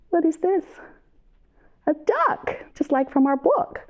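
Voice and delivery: put-on high excited question to little kids; reading to a toddler. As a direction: ask it like a storytime guessing game. Asks children during song.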